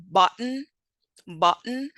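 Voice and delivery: "button" with a catch in the throat for the T; "button" said as "but'n", with a glottal stop. Shown as a demonstration. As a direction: stop the breath before the final n in "button". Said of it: "notice how there's a stop between".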